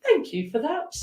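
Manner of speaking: high, sing-song mock thank you; sarcastic thanks. Reader voicing the client's gloating thanks to the gossip. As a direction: thank them with a sly, gloating rise. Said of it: "a little bit of a sadistic laugh of".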